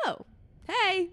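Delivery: lifted "oh hey" way up high; playful imitated hello; high bright greeting. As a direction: greet him with a sing-song lift. Her own reply greeting.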